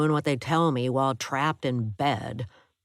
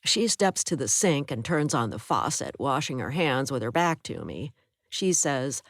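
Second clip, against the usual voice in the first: ordinary delivery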